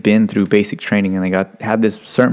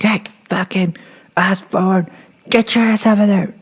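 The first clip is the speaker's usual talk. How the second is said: higher barked order, hammed up; mock drill instructor yell; 10 semitones higher than usual. Retelling boot camp, recruit mimics instructor's mock yell. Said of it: "they're like".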